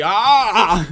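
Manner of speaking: sharp loud startled gasp of a word; high, loud startled yelp; startled cry. Acted. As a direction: jolt awake in alarm. Startled NPC wakes and sees you.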